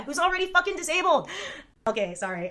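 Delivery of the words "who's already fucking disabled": indignant rant rising to a high, loud finish; worked-up anger. Real, not acted. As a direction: build in outrage to the last word. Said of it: "I was so mad".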